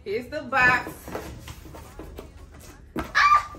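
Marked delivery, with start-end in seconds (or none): 3.0-3.5 high breathy squeal of delight; excited squeal. Real, not acted. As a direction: squeal at the new vacuum. Opening the vacuum box.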